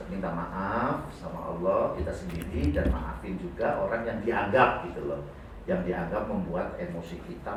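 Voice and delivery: ordinary talk, nothing marked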